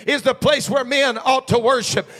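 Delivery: loud, high fervent preaching; full-throated pulpit cry. Acted. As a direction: shout it out like a revival preacher.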